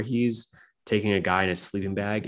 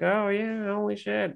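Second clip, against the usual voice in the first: high, airy imitation of useless cops; put-on clueless officer; mock cops; 12 semitones higher than usual. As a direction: feign concern in a raised, softish voice. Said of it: "The cops are like".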